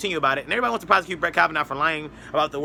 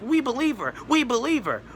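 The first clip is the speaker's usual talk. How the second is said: high-pitched mimicking chant; mock liberal media; 8 semitones higher than usual. Liberal media backing the accuser, high mocking chant. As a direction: imitate insistent believers, pitch swooping down. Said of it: "The liberal media is like".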